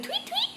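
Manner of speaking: very high, light birdsong imitation; bird chirp. Shown as a demonstration. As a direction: chirp it like a little bird for a toddler. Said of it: "you hear the birds out there".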